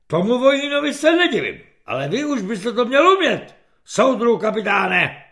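loud, high colonel's dressing-down; angry reprimand. A narrator's reading. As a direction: scold a subordinate officer in public. Colonel scolds the humiliated captain.

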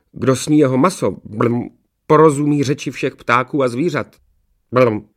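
loud, burbling devil voice; devil servant. A narrator's reading. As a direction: report to the master, gurgling between phrases. Devil Blekota reports with burbling noises.

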